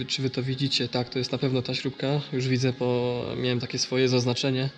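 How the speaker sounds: normal talking voice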